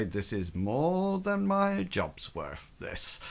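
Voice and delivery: low, slow, quiet grumble; grumbling footman. Acted. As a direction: mutter it low to yourself. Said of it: "as if to say".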